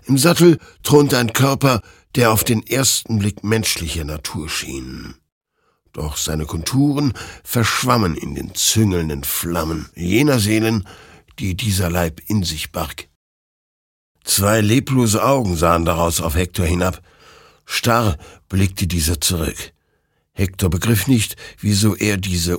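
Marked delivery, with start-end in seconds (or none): none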